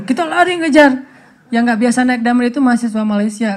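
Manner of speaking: ordinary talk, nothing marked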